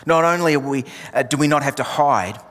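ordinary delivery

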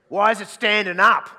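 loud, high complaint; older man loudly objecting to the hair; indignant older guy. Retold or imitated. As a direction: blurt it out, outraged. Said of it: "they'll say something like this".

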